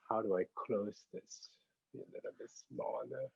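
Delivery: hushed, absent-minded self-talk; muttering to oneself. Real, not acted. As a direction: murmur to yourself while fixing the screen. Talking to self, fiddling with screen.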